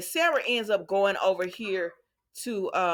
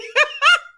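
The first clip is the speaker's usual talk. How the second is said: loud, very high burst of laughter; shrieking laugh; 10 dB louder than usual. Real, not acted. Host reacts to babysitting request, shrieking laugh. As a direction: crack up sharply, high and loud.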